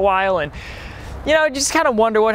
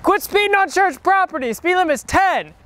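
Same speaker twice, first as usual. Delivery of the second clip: high yelling at a speeder; angry shout; 10 semitones higher than usual. Acted. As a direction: yell after the driver.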